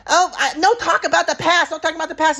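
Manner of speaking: high put-on mom voice interrupting; mocking mom impression. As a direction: cut in high and loud like the mom. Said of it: "So I'm like".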